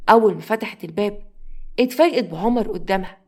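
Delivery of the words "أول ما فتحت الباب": ordinary delivery, nothing marked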